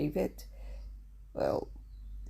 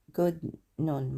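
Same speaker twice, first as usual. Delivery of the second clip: usual speaking voice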